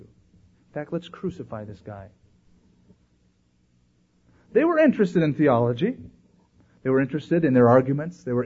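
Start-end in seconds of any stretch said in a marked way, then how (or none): 0.0-2.1 hushed, low plotting; conspiratorial. Acted. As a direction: drop to a near whisper, as if plotting. Acting plotters' secret reaction to Jesus.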